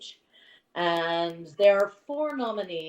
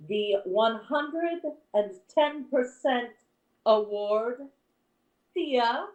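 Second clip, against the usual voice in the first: winner announcement; 6 semitones higher than usual. Acted.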